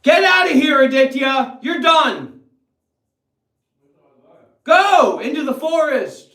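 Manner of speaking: hammed-up shout sending Aditya away; mock banishing shout. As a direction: throw him out in jest. Mock banishing his friend.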